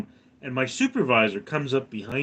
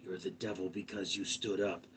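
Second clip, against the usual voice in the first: much softer, lower, creepy murmur; eerie hushed accusation; 6 semitones lower, 13 dB quieter than usual. Retold or imitated. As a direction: hush it like a spooky accusation. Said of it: "and he goes".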